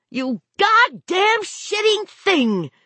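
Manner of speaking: high, slow, strained furious cry; exasperated fury. A narrator's reading. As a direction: curse the thing, drawing out every word. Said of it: "she cried in an exasperated fury".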